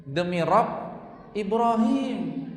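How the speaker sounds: Ibrahim drawn out high and slow; half-acted annoyance, stretching out Ibrahim; mock angry oath. Preacher voicing Aisyah's cross oath to her husband, stretched out.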